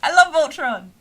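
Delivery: high, loud burst of fan enthusiasm; excited fan shout. Pretend. Streamer gushing to viewers about a show, excited shout.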